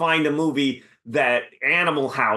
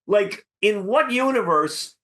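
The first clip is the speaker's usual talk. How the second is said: incredulous retort; 7 semitones higher than usual. Retold or imitated. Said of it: "I'm like".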